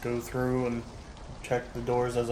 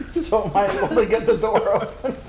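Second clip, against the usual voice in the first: higher, louder, through laughter; laughing question; 8 semitones higher, 5 dB louder than usual. Real, not acted. Player asking game master about the door, laughing. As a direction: ask it while still cracking up.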